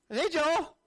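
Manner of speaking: calling out. Retold or imitated. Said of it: "And, uh".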